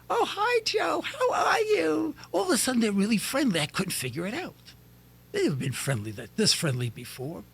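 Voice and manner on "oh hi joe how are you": high, sugary falsetto greeting; flirty neighbour woman. Retold or imitated. Neighbour women sweet-talking Joe.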